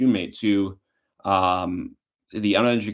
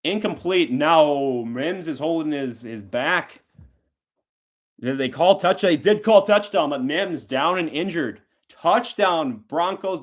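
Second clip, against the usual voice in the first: raised, urgent live call of the play; breaking-news sports call, pitched up; excited play-by-play; 4 semitones higher, slower than usual. Real, not acted.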